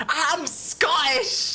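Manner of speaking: put-on laughing Scottish-accented quote; quoting the Scottish line. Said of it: "now what does that make you?".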